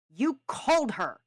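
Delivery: loud, high angry accusation; furious, emphatic outburst; raging. A narrator's reading. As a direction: hurl the accusation in a rage. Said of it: "Callum raged".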